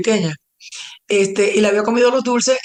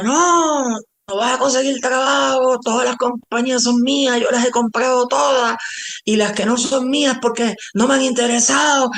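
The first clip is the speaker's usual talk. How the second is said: higher-pitched ranting, imitating an out-of-control boss; mock a boss's unhinged tirade, voice raised in pitch; imitated angry yelling; 4 semitones higher than usual. Boss threatening the employee who just quit, imitated ranting. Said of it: "me empezó a gritar bien, bien descontrolado".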